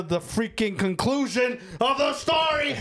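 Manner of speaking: sing-song shout. Acted. Ranting, voice bursts into sung shout.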